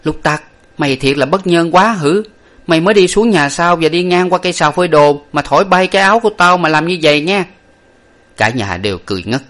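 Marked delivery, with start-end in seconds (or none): 0.0-7.4 high-pitched indignant woman's telling-off; high, loud scolding of a servant; finger-pointing scolding. A narrator's reading. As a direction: scold the thief in a raised, rapid-fire harangue. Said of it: "điểm mặt thằng Lục Tặc và nói".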